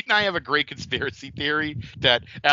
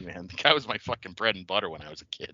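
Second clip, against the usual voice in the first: ordinary delivery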